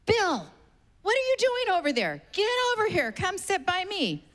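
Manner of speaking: put-on a high, insistent old lady summoning Bill; imitating Grammy. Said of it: "Grammy goes".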